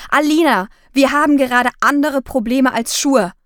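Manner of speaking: impatient huff; snorting. Acted. Mother snorts impatiently at her daughter about the shoes. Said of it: "hat Mama geschnaubt".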